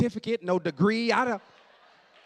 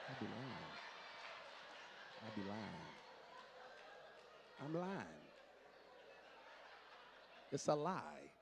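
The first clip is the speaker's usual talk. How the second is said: much softer, lower confession; confiding aside; 9 semitones lower, 16 dB quieter than usual. Acted. Preacher confides to the lady, hushed and low. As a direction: let them in on the secret.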